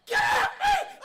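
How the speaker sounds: very high, full-volume shriek; screeching mock outburst; imitated scream. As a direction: act out a panicked public scream. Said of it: "Bro, he screamed".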